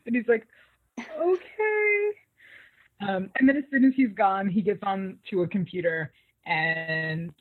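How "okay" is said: high, drawn-out mock okay; retold compliance. Man told not to leave, high mock-compliant reply. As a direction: stretch it out high, imitating someone agreeing.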